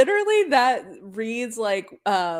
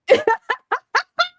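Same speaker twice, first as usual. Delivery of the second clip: loud laugh; 6 dB louder than usual. Real, not acted. Laughing at cousin remark.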